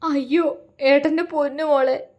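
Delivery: wailing. A narrator's reading. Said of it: "അലറിക്കരഞ്ഞു".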